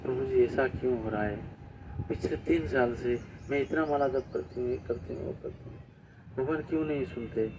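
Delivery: soft, hushed, tearful complaint, fading almost to nothing; imitating a devotee half in tears, quietly; tearful plea. Devotee crying to the monk about her failed exams, soft tearful plea. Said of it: "बहुत रो रही थी".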